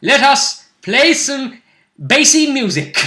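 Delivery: loud, high, slow proclamation; hammed-up decree, each word drawn out; mock-grand command. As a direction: belt it out with playful pomp. Hammed-up mock command to play music.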